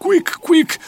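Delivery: startled. Acted. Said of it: "m-ai speriat!".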